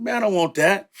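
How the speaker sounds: high, scoffing turn-down; mock refusal. Producer scoffing at Master P's offer of tapes, high mock refusal.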